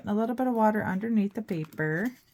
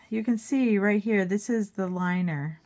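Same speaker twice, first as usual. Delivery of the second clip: ordinary talk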